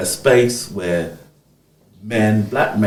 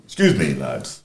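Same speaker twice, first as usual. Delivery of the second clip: lower, imitating a British policeman's accent; 6 semitones lower than usual. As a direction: put on the copper's accent for Excuse me, lads. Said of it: "Sorry to put the accent".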